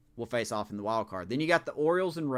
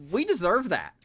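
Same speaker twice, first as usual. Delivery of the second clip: louder, higher, bitter admission; sharp, loud self-reproach; frustrated outburst; 6 semitones higher, 5 dB louder than usual. Real, not acted. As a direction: own the loss out loud. Frustrated fan rant.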